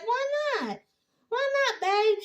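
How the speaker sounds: high pleading whine, imitating Zach; whiny high Zach. Zach begging his girlfriend to let him jog, high mock whine. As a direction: beg to come along in a high mock whine. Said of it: "he was like".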